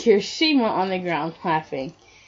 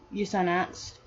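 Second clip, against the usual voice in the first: usual speaking voice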